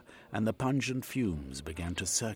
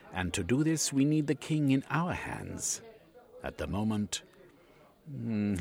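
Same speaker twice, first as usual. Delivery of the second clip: high, condescending agent; smug Yegman; 6 semitones higher, 3 dB louder than usual. A narrator's reading. Yegman mocks Cornucope's Britishers, condescending. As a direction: talk down to the old Briton.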